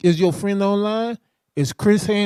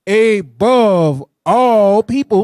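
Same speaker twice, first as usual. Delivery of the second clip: "Above all people" drawn out slow and loud; stress on all three words; 4 semitones higher, 6 dB louder, much slower than usual. Real, not acted. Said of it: "repeats verse emphatically".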